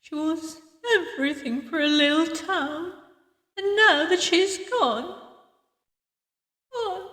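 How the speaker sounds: put-on grieving old woman, holding herself together; mournful tribute fading into a soft "oh"; pained voice. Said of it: "pain still showing in her voice".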